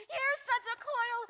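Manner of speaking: high, loud, slow outburst; exasperated exclamation. Acted. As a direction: burst out at all the fuss. Juliet exclaims at nurse's fuss.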